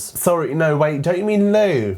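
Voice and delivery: high, hammed-up polite correction; mock British friend. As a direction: play a baffled friend correcting someone. Voices British friend correcting Anna.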